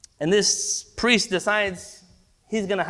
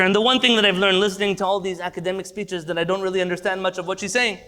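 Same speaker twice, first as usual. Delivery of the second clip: usual speaking voice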